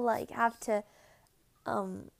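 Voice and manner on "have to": usual speaking voice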